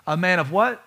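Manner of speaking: ordinary delivery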